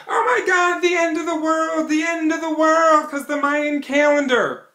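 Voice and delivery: high, hammed-up panicked cry; mock panic. Mocking doomsayers, a high hammed-up panic. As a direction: mimic doomsayers freaking out. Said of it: "Everybody's going".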